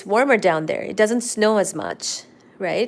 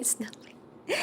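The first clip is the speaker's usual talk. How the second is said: whispered; 2 semitones higher, 15 dB quieter than usual. Shown as a demonstration. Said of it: "Oh, no way".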